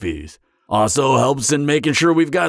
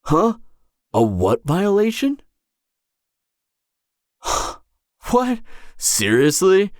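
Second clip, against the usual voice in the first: baffled surprise; 7 semitones higher than usual. Acted. Date mentions a privacy violation.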